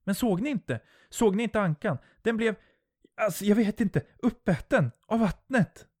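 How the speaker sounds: high, distraught pleading; frantic disbelief. A narrator's reading. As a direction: struggle to explain, panic in the voice. Panicked witness explains to disbelieving friends. (förtvivlat och uppjagat)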